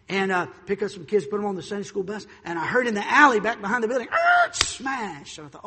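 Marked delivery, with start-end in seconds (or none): 4.1-5.4 screeched high and loud, then a crash; sound-effect screech into a loud smash; imitated car crash. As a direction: mimic squealing tires and impact.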